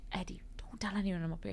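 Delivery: pleading whisper; whispered plea from the attic. Retold or imitated. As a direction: hiss it so no one hears. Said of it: "And I go".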